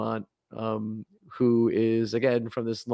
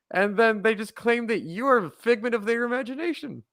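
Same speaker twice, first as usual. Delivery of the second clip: much higher, incredulous outrage; escalating comedy rant; 10 semitones higher than usual. Real, not acted. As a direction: build the disbelief line by line. Outraged riff on the church.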